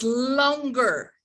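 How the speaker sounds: "longer" with its first syllable stretched long; drawn-out stress. Shown as a demonstration. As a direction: draw out the LON of "longer". Teacher demonstrates length on the word.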